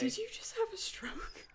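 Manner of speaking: hushed mock whisper. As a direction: whisper it like a secret. Joking whisper after the impression.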